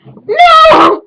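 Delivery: long, very high, loud theatrical scream. Acted. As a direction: wail it out in mock horror. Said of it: "I screamed".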